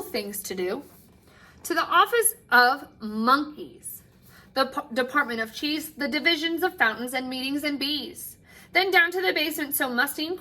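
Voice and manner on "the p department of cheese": usual speaking voice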